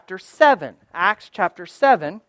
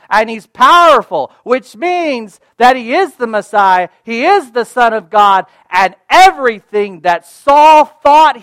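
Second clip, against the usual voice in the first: rising preaching; 4 semitones higher, 9 dB louder than usual. Acted. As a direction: build it up like a revival preacher. Preacher building to climax.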